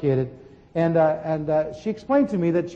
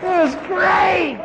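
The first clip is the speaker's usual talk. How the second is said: excited; 12 semitones higher, 5 dB louder, much slower than usual. Real, not acted. Excited joyful recollection.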